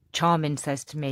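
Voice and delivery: ordinary talk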